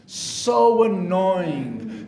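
very slow, dragged-out complaint; exasperated, elongated repeat; drawn-out exasperation. Real, not acted. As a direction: stretch every syllable long. Exasperated repeat about empty testimonies.

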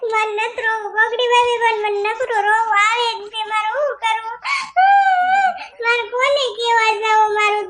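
a hammed-up teary young wife; weepy wife. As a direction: whine out your heartbreak to the sister-in-law. Abandoned wife complains tearfully.

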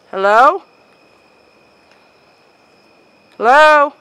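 loud, high call into the dark. Real, not acted. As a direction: call out loudly. Calling out to shadow.

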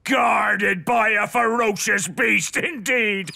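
hearty pirate proclamation. Acted. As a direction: boom it out like a pirate captain. Pirate Redbeard dramatic declaration.

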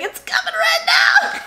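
put-on high, loud little-kid cry through laughter; laughing high child's yell; excited child shout. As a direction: shout it like a toddler mid-poop, laughing. Child voiced in story, shouted.

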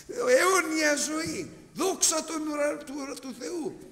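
high, slow shout of Αιώνια ζωή; ecstatic shout. Acted. Preacher proclaims eternal life to the brethren, shouting slowly. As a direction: cry it out to the congregation.

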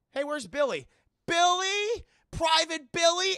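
loud, high searching call; hammed-up questioning shouts. Soldiers calling for missing comrade.

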